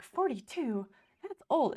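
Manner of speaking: shocked at the age, half-joking; mock shock. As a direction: gasp it out like it's ancient. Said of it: "at first, I was like".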